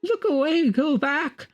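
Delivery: very high, breaking terrified cry; a doomed man crying out as he falls; anguished horror. Acted. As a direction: scream the warning, voice cracking. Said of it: "horror and anguish of a haunted lifetime".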